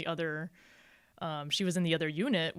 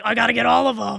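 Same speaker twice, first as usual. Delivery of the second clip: high, loud imitation of eager buying; mock frenzy; 5 semitones higher, 11 dB louder than usual. Teasing co-host as he spots sevens, mock excitement. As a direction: act out a shopper's frenzy, loudly. Acts out his frantic buying.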